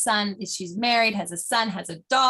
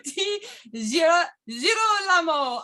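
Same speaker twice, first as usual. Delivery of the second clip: hammed-up Italian; 9 semitones higher than usual. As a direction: belt out "Girolamo" with an over-the-top Italian swing. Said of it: "I wanna say it Italian".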